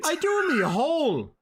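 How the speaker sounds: loud, high Dublin retort; broad Dublin accent. Acted. Host protesting to teasing co-host, broad Dublin accent. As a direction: throw it back in thick Dublin. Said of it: "I'm speaking Dublish".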